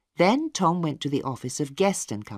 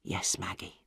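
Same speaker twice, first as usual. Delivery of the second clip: much lower, soft whispered promise; 9 semitones lower, 11 dB quieter than usual. A narrator's reading. Tom answering his sister after father dies, whisper. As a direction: hush it at the deathbed. Grieving son at deathbed.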